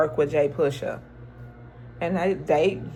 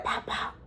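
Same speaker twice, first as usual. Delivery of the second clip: unvoiced breathy pop pop; gunshot imitation; 12 dB quieter than usual. Retelling [name] shooting, soft imitated gunshots. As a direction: mimic two quick gunshots softly.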